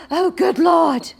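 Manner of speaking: high, loud startled gasp; frightened gasp. Acted. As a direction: jump with fright. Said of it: "jumped with fright".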